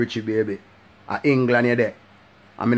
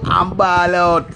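drawn out; 7 semitones higher, 6 dB louder, much slower than usual. Acted. After shouted catchphrase.

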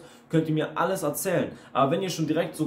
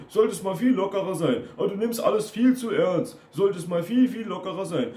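higher-pitched mocking whine; mocking stock phrases; 6 semitones higher than usual. Coach mimics critics telling him he is too sensitive, mocking. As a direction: parrot their nagging, pitched up. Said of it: "Das sind dann diese Standardsprüche".

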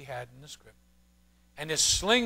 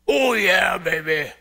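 loud wrestler catchphrase, big and brash; Hulk Hogan shout; 6 dB louder, slower than usual. Retold or imitated. Preacher recalls Hulk Hogan for the congregation, loud wrestler shout. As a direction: bellow it like a pro wrestler on the mic. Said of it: "Remember Hulk Hogan?".

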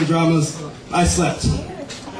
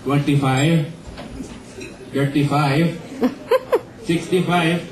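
put-on Indian accent; 4 semitones lower, slower than usual. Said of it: "little Indian salesman in my head".

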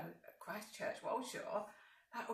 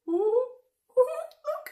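much higher, louder ooh; excited ooh; 13 semitones higher, 12 dB louder, slower than usual. Acted. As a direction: squeal at the spider. Excited at spider.

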